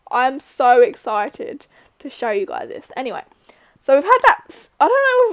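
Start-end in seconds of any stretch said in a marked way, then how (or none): none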